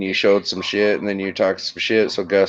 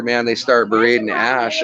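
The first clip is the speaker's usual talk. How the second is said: normal talking voice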